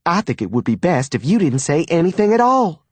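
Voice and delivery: quick, high, sharp retort; snapping. A narrator's reading. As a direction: snap it out fast and cutting. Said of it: "she snapped".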